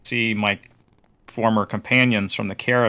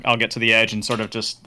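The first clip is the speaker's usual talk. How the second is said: ordinary talk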